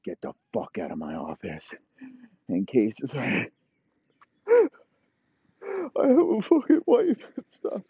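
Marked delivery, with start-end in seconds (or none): 0.0-1.7 low, soft dismissal; quietly threatening boss voice; cold menace. Acted. Boss coldly dismisses stabbed player. 4.5-7.8 very high, slow wail; hammed-up high-pitched whimpering; mock anguished plea. Stabbed player pleading.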